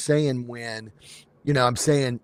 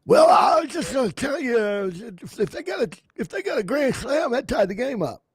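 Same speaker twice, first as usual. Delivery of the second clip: Harry Carey impression; 10 semitones higher than usual. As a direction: belt it like a tipsy ballpark announcer. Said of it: "Yeah, Harry Carey".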